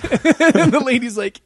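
usual speaking voice

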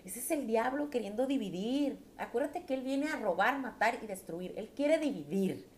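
ordinary talk, nothing marked

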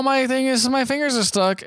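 mock pained whine. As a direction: imitate a clown with trapped fingers. Acting out trapped-fingers slapstick.

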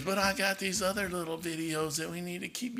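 imitating his wife. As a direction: quietly mimic his wife pleading to keep her videos. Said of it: "she goes".

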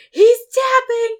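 yelled out high and loud, slowed down; retold shout. Fighter on top yelling to the ref that his opponent taps, loud retold shout. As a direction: shout it across the mat to the referee. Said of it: "the guy on top going".

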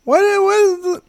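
very high-pitched imitation of a small child; clueless little kid. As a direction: squeak it out like a baffled youngster. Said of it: "You're probably like".

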